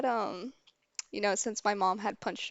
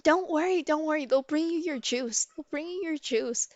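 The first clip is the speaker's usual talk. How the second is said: put-on high-pitched little-kid voice, starting louder; little sister; 7 semitones higher, 4 dB louder than usual. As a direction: imitate a small child offering comfort. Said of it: "and she's like".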